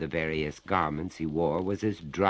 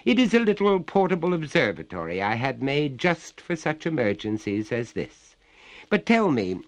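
lightly voiced gentleman, raised pitch; a touch higher, politely inquiring; courteous master of Harrowby; 6 semitones higher, 4 dB louder than usual. Master questions the ghost, lightly acted courtesy.

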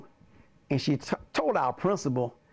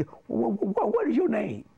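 higher stuttering mock question; put-on halting interrogation; imitating the principal's stammer; 6 semitones higher than usual. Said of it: "he started talking like this".

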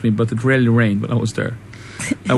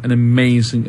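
usual speaking voice

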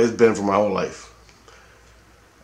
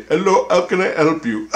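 mock Pakistani accent, higher and louder; 8 semitones higher, 5 dB louder than usual. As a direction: put on an exaggerated Pakistani accent. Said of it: "With that accent they have".

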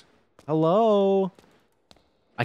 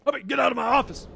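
higher, faster, bossy put-on voice; mock bossy; 6 semitones higher, faster than usual. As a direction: shoo him out like an annoyed boss. Telling NPC to leave office.

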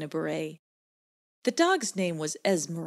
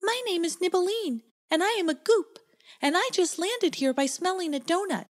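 high little goop voice; 13 semitones higher than usual. Acted. Goop character voice, much higher.

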